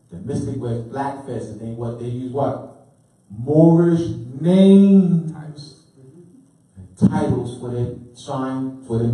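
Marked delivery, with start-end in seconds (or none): 3.3-5.3 slow and loud, stretching Moorish names; a preacher's drawn-out Moorish names; emphatic answer. Shown as a demonstration. Teacher repeats answer emphatically.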